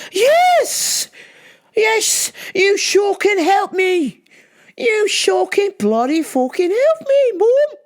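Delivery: high, exasperated fake English accent; phony English accent. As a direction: shout indignantly in a hammy English accent. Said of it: "I'm using a phony f-, English accent".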